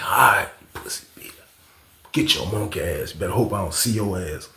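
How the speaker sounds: low, hushed menace; whispered threat. Retold or imitated. Threatening the neighbor at the door, whispering. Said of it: "I'm like".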